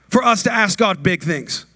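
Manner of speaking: usual speaking voice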